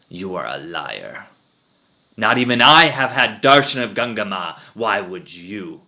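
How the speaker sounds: accusing guru. Acted. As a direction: call her a liar before the crowd. Guru accuses old lady publicly.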